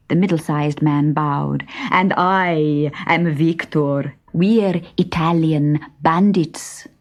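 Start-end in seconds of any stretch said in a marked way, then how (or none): 1.9-6.9 slow, grand bandit announcement; a slow theatrical bandit boast; proud bandit. Acted. Bandit introducing himself.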